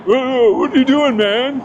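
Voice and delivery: high-pitched, spaced-out Californian hippie impression; burned-out Californian hippie. Mentally ill stranger confronting someone with a camera, hippie impression. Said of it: "I like your impression of a".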